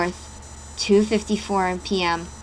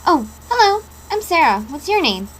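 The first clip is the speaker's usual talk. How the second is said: high, louder cheerful hello; bright greeting; 6 semitones higher, 5 dB louder than usual. Acted. Girl greets the boy beside her, higher and louder. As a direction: greet him brightly, a bit surprised.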